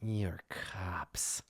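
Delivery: low, quiet accusation; suspicious dog owner. Acted. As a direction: say it low, as if sizing them up. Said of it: "says it in the same way of being like".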